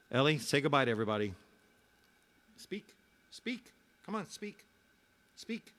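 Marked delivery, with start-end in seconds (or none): 2.6-5.7 soft, high-pitched dog command; high, soft pet-talk urging; coaxing a dog. Real, not acted. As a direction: coax the dog in a high little voice. Coaxing his dog to speak.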